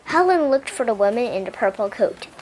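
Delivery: ordinary delivery, nothing marked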